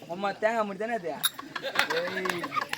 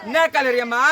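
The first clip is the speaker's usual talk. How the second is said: louder, demanding question to the woman; shouted question; 7 dB louder than usual. Acted. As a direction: call it out loudly. (சத்தமாகக் கேட்கும் கேள்வி)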